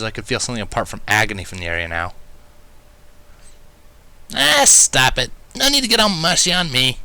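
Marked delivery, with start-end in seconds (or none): 4.3-7.0 embarrassed groan. A narrator's reading. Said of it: "groaned, sounding embarrassed".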